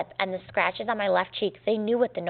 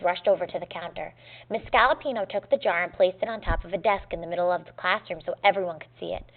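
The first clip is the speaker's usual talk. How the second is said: usual speaking voice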